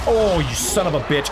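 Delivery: exasperated curse, pitched high then falling; real frustrated outburst. Real, not acted. Streamer caught out by the game, frustrated outburst. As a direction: curse at the game as it gets you. Cursing at the game mid-play.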